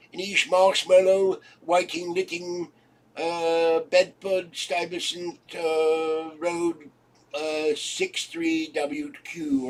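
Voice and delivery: lightly British rambling address; hammed-up English accent reading an address; mock British address. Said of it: "ten Downing Street".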